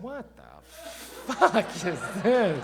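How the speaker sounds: rising to a loud, incredulous cry; stunned outburst. Retold or imitated. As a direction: react in shock to the weird pitch. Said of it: "I'm on the other line going".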